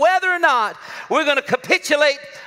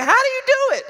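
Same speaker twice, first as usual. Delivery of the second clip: incredulous high; 14 semitones higher, 3 dB louder than usual. Retold or imitated. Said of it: "I'm like".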